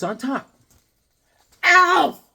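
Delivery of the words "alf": high, loud burst of delight; excited shout. Real, not acted. Host finding Alf in the box, excited shout. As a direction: shout it like a prize find.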